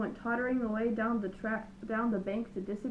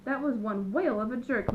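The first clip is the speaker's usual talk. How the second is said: plain reading voice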